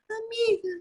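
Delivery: high squeaky falsetto whispered in the ear; mock-whisper in a tiny, very high voice. Girl singer whispering close in her friend's ear, squeaky falsetto.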